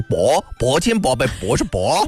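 slow imitated Zibo dialect; Zibo accent. Host retelling how a friend counts in Zibo dialect, Zibo accent. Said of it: "你们那个淄博话讲一二三四五六七".

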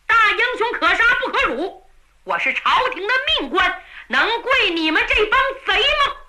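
high-pitched, indignant retort; defiant captive. A narrator's reading. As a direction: stand on your rank and refuse. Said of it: "王大鹏一瞪眼睛".

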